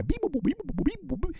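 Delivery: high beeping; robot beeps. Retold or imitated. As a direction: chirp like a machine. Mocking future song.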